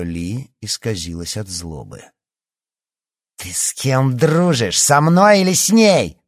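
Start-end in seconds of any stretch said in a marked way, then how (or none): 3.4-6.2 slow demand rising to a yell; building, high and loud challenge; angry shout. A narrator's reading. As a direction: shout it as a furious ultimatum. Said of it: "крикнула она".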